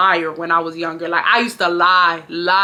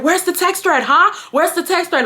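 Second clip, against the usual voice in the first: higher-pitched demanding mom quote; mom getting loud; 9 semitones higher than usual. Retold or imitated. Mom demanding to see the text thread, getting loud. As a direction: imitate mom getting worked up. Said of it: "she's getting loud".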